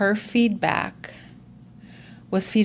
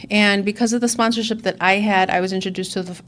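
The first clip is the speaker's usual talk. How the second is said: ordinary delivery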